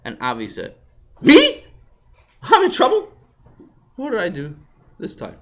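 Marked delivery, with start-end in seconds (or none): 1.2-5.4 high loud astonished; surprised. Acted. Avi to the table, surprised. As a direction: gasp it out.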